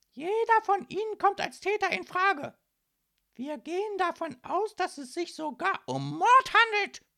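high, loud, overbearing tirade; shrill policewoman building to the accusation; threatening. A narrator's reading. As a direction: intimidate the suspects with every word. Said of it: "drohte nun die hochgewachsene Polizistin".

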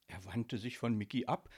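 plain reading voice

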